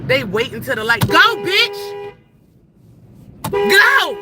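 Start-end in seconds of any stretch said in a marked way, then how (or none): 1.1-4.2 loud, high-pitched road-rage yell; angry yelling. Real, not acted. Driver yelling at slow traffic ahead, angry shout. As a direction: yell it at the driver holding you up.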